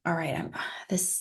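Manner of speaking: sighing whisper of acceptance. Pretend. Coach showing acceptance to listeners in a sighing whisper. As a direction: breathe it out in a whisper.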